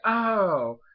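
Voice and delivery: loud, high drawn-out "oh"; sudden realization. Retold or imitated. Clement seeing what he's doing, sudden realization. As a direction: play the penny dropping.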